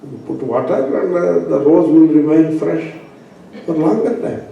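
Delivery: ordinary delivery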